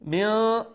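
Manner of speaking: "Min" with the n hidden in a held nasal sound; lingering, softened n at the end of "Min"; ikhfa on nun. Shown as a demonstration. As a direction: let the n of "Min" hum through the nose. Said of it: "we will pronounce the nun sakin with".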